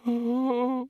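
high, wordless, drawn squeak. A narrator's reading. Senior wizard embarrassed by the topic, squeaking. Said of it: "squeaked the senior wrangler".

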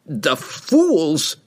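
high, angry outburst; angry growl. A narrator's reading. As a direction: growl it in outrage at the fools. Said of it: "he growled".

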